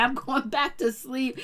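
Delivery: slow, groggy brush-off; sleepy dismissal. Retold or imitated. Alex tossing the baby aside, groggily heading back to bed. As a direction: drag it out like you're already half asleep. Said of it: "He said".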